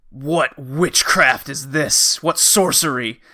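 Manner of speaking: slow, grave olde-timey father proclaiming; dramatic father. Retold or imitated. Father ordering everyone home from the forest, slow and grave. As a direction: intone like a medieval patriarch. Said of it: "And he goes".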